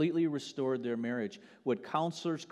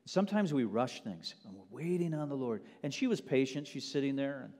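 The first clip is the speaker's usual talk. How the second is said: ordinary delivery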